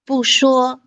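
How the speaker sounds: 不说 with 不 falling, 说 level and high; bù shuō demo. Shown as a demonstration. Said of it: "说一声".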